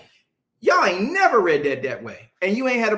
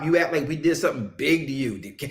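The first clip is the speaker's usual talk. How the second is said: normal talking voice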